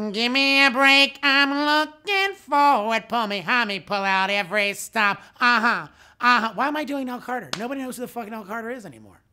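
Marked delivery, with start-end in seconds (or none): 0.1-6.5 high, loud, slow belted sitcom diva. Retold or imitated. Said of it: "This is my impression of [name]".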